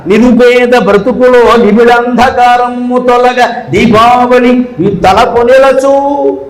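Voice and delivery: slow, drawn-out chanted verse; పద్యం నెమ్మదిగా రాగంతో; poem recital. Acted. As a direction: intone the final verse, stretching it out.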